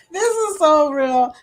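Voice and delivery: delighted. Real, not acted. Streamer agreeing with a chat comment, delighted. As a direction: exclaim it with glee.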